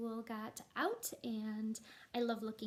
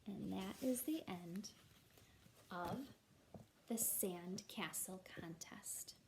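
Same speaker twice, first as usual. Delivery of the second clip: slow, soft whispered ending; 3 semitones lower, 7 dB quieter, slower than usual. Acted. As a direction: breathe the last words like a secret. Whispers the story's last words.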